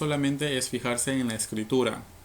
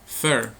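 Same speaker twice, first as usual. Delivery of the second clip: FER punched out louder on its own; stressed syllable; 4 dB louder than usual. Shown as a demonstration. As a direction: hit "fer" as the strong syllable. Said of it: "la sílaba con mayor fuerza de voz".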